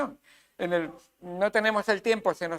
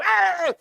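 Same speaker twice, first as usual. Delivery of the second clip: short sharp yell, much higher; mock yell; 12 semitones higher, 6 dB louder than usual. Preacher acting out yelling at wife, mock yell. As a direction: bark it like shouting at home.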